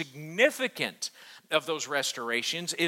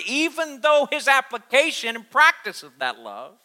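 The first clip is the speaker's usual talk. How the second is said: louder and higher, driving the point home; preaching at full force; 6 semitones higher, 7 dB louder than usual. Acted. Pastor urging the congregation, preaching at full force.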